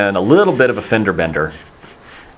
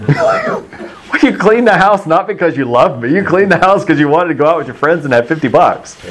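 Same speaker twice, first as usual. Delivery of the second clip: laughing accusation; 7 semitones higher than usual. Real, not acted. Preacher mock-scolding teen.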